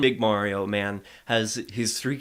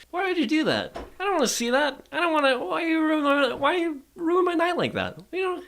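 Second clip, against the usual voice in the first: much higher, whining complaint; whiny high voice. Real, not acted. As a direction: whine like your night is ruined. Whining about gross warehouse.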